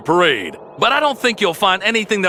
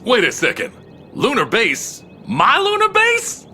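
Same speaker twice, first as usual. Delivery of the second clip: dawning surprise; 7 semitones higher than usual. Acted. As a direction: catch yourself mid-story and panic as it sinks in. Narrator realizes pirates reach his base.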